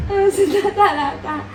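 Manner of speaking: high voice breaking into laughter; laughing protest. Real, not acted. Woman refusing to take him back, laughing protest.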